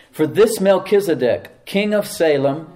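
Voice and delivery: slow, loud, deliberate reading of King James; slow scripture reading. Shown as a demonstration. Preacher reading scripture slowly to the congregation, loud and deliberate. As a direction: read each phrase slowly and weightily. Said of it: "We're gonna read it really slowly".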